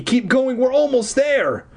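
high urgent push; urging. A narrator's reading. Girl urges her brother down the stairs. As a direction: urge him on fast. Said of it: "I urged Eddie".

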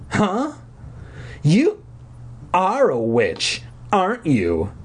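high opening, then slow accusation; accusing. A narrator's reading. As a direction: draw out the accusation. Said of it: "she accused".

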